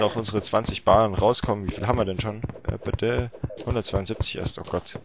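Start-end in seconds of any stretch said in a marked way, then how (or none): none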